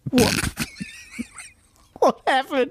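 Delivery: squeaky high voice. Acted. Surfer bit, squeaky.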